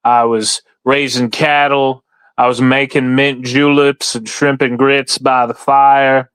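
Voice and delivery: hammed-up Southern farmer, stretched out. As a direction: spin a yarn like a Southern gentleman farmer. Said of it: "I can't keep doing the voice too long".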